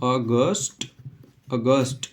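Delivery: अगस्त said "aa-GUST", ending in a hard English t; English pronunciation. Shown as a demonstration. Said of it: "इसका correct pronunciation होगा".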